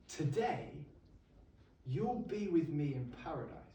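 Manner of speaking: a dying man's soft promise to a criminal; soft quote of Jesus. Acted. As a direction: say it softly, as a quiet promise. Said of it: "And he turns to him and says".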